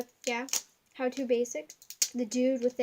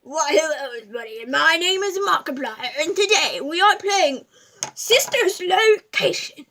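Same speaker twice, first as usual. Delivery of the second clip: Markiplier impression; 3 semitones higher, 11 dB louder than usual. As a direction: boom it like a YouTuber opening a video. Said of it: "my impression of Markiplier".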